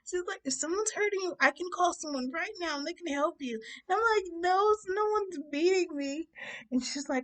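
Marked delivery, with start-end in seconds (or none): 0.4-3.6 high-pitched earnest rescuer; concerned woman. Retold or imitated. 4.3-6.3 high mock-sobbing protest; tearful denial. Crying denial in retold breakdown.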